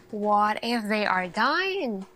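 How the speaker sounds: ordinary delivery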